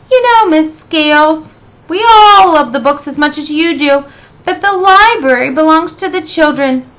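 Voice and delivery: sweet Miss Lemon. Acted. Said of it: "sweet Miss Lemon".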